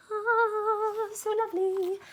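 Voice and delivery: high, dreamy "ah, so lovely"; dreamy sigh. Acted. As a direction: swoon over the music. Swooning over twin violins.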